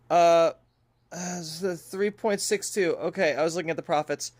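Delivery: ordinary talk, nothing marked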